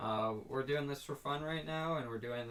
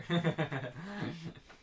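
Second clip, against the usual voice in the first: laughing; 6 semitones higher than usual. Real, not acted. Laughing at own joke.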